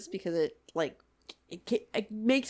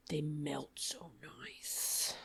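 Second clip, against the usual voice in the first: slow dreamy whisper; hushed, slow and blissful; 5 semitones lower, 13 dB quieter, much slower than usual. Real, not acted. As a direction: breathe it out, savoring. Savoring chocolate chips, whispered.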